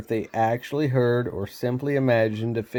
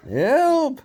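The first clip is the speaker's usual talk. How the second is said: panicked cry; 13 semitones higher than usual. Acted. Rob panics at the fish.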